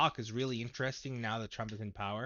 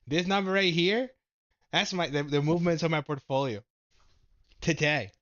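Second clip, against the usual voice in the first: raised, higher voice in real frustration; exasperated venting; 5 semitones higher, 7 dB louder than usual. Real, not acted. Streamer showing viewers the day's losses, exasperated. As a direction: loudly stress the bad numbers.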